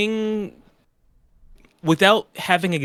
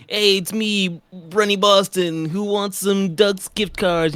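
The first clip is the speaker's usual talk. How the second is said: put-on lightly Boston-accented promo voice, drawn out; mock Boston pitch; 5 semitones higher, slower than usual. Cohost pitching a Dunkin' giveaway in a light Boston accent. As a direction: hype it up like a Boston coffee mascot. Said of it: "You can be like".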